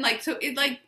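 ordinary talk, nothing marked

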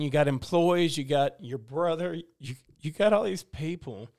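ordinary talk

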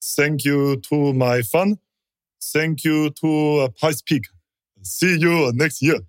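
put-on slow, halting Japanese-accented thanks; a slow Japanese-accented thank-you speech after the race; imitating Tajima's accent. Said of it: "he goes".

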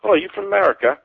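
high-pitched imitation of a British commuter; imitated British stranger. Stranger on the London tube asks an American, imitated British accent. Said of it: "someone on the, on the tube next to me said".

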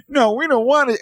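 high whiny mock refusal; mocking whiny politician. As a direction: whine like a spoiled pansy. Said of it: "bunch of Nancy pansies putting their hand like".

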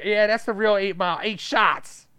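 loud, high gloating; mock triumphant. Rapper gloating after the shooting, loud and high. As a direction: crow it like a punchline. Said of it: "It's like".

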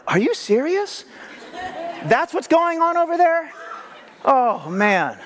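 high, incredulous gasp; mock shock. Preacher imagining God shocked by world news, high incredulous. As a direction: act shocked as if hearing news. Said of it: "He's not like".

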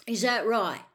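loud, rough challenge; rough, defiant. A narrator's reading. Frank challenging the cold voice, roughly. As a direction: fire it back roughly and loudly. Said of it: "said Frank roughly".

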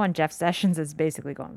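ordinary delivery

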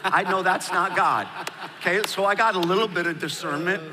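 amused at his own joke; chuckling while admitting it; laughing. Real, not acted. Laughing at own absurd example.

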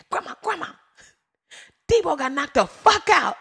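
put-on high, loud kid shouting the news; a small boy bursting with news; hyper excited kid. Nephew runs up to grandma with movie news, hyper and excited. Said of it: "He was so hyper and excited".

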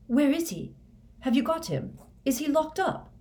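high-pitched, frantic questions; wild questioning. A narrator's reading. Harry demands news of the traitor, wildly. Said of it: "said Harry wildly".

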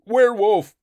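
very high, very loud shriek; panicked scream. Acted. Thord shrieks in panic as the beast holds his wrist. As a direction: scream in terror. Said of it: "he screamed".

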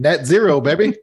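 excited. Acted. Playful exclaiming catchphrase.

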